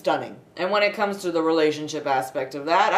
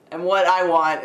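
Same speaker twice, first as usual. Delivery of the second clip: ordinary talk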